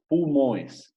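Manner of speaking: pulmões ending in nasal "õis"; nasal -ões plural. Shown as a demonstration. Teacher models Portuguese plural, pulmões ending in nasal "õis". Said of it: "hay que leerlas como nasales".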